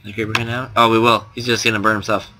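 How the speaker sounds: usual speaking voice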